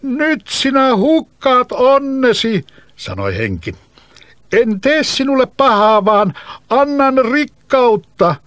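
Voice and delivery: high, loud, slow coaxing voice; bottled spirit pleading loudly; tempting spirit. Acted. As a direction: lure the boy with riches. Spirit begs boy.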